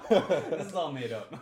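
loud, drawn-out, laughing protest; real laughter breaking through the words. Real, not acted. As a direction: crack up while saying it. Cracking up at a made-up name.

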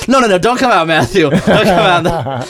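high-pitched retold protest; imitating a flustered stage announcer; mock urgent plea. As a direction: hurriedly wave off a guest, play-acting. Acted plea in retold bit.

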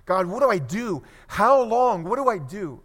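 loud, high desperate plea; acted-out despairing wail in words; crying out to God. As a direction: build to an anguished cry for help. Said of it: "he's just crying out to God".

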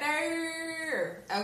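high echo of a bad Australian accent; playful, high Aussie imitation; mock Australian accent. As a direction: mimic the exaggerated Aussie vowel. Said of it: "That's my Australian accent".

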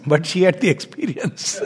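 laughing through the reply; amused punchline. Retold or imitated. Ferryman explaining who goes beyond, amused. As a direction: let the retold line tip into laughter.

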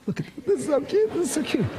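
high, fast gushing over a video. Retold or imitated. Wife gushing over grandson video, mock high squeal. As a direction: gush like a doting grandma. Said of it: "there she is gasps".

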